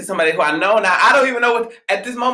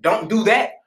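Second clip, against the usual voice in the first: measured command, pitched up; stern; 5 semitones higher, slower than usual. Acted. Setting a boundary with somebody, stern. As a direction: put your foot down on each phrase. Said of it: "the moment you be stern or you put your foot down".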